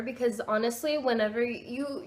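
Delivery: ordinary talk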